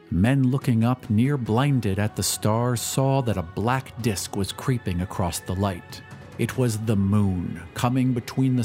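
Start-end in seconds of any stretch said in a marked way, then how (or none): none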